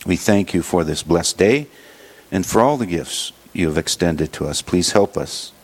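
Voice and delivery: usual speaking voice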